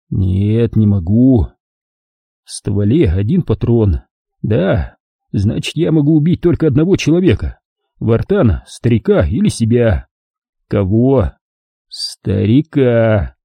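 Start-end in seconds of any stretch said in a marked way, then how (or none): none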